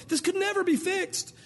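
put-on high, slow wail of a hopeless kid; overwhelmed child. Child overwhelmed by a broken toy, high despairing voice. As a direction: play a little kid in despair. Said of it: "It overwhelms them".

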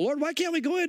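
high-pitched mock plea to the Lord; hammed-up impatient whine; impatient pleading. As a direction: play an eager petitioner, voice pushed way up. Acts out heaven pleading with God.

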